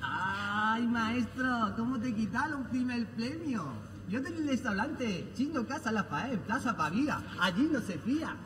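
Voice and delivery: low, soft mock Chinese accent. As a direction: play a Chinese restaurant owner. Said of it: "¿Y en chino?".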